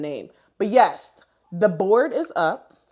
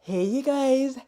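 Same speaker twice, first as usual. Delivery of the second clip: put-on high, drawn-out Haitian-accented greeting; slow singsong hello in an imitated Haitian accent; Dayana; 6 semitones higher, 4 dB louder, slower than usual. Imitating a friend greeting followers in her story, Haitian accent. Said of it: "when you in her story and she be like".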